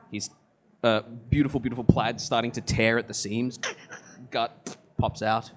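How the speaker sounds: usual speaking voice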